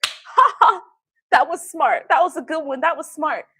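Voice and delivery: high, loud mock rich laugh with claps; rich man's laugh. Drunk attorney laughing it off at his own table, mock rich laugh. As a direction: ham up a smug tycoon chuckling. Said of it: "you know that rich laugh?".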